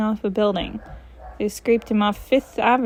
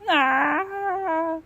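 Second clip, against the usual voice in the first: short startled cry; 6 semitones higher than usual. Acted.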